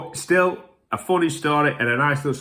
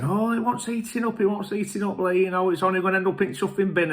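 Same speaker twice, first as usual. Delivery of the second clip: higher, coaxing host voice; pitched-up mock father-in-law pleading; father-in-law urging food; 9 semitones higher than usual. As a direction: push the food on him like a keen host. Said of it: "Every time he was saying".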